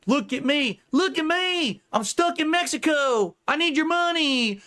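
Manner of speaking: high, slow whiny beggar voice; mock a streamer begging for cash from Mexico; mocking Ethan Ralph voice. Mocking a rival streamer, high and slow whiny plea. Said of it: "cartoon character to attribute that voice to".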